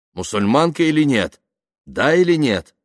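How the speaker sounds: loud, high, pressing questions; insistent demand. Acted. Man questions silent woman on her faith, insistent demand. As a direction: press for an answer.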